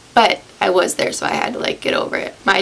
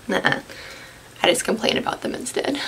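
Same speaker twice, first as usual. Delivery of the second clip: ordinary talk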